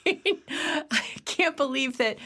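normal talking voice

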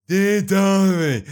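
slow, high, grand diary opening; hammed-up reading voice, slow and lifted. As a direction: read the first line like a solemn ceremony. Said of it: "Let me put on my reading voice".

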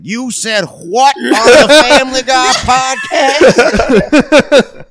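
Steve Harvey impression. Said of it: "Steve Harvey's like".

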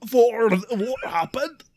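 broad fake Scottish accent; cartoon Scottish accent. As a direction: do an exaggerated cartoon Scot. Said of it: "That's Scottish the way that Willy".

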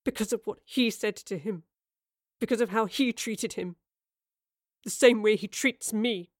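halting, emotional confession; choking back tears. A narrator's reading. Young man likening brother's treatment to his own, choked up. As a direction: force the words out, a touch tearful. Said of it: "trying not to choke on the words".

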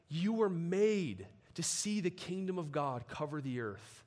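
ordinary talk

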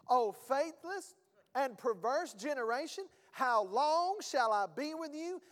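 frustrated outburst, stretched out and rising at the end; aggravated. Acted. Jesus frustrated with his disciples, aggravated. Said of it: "sound like somebody who's aggravated".

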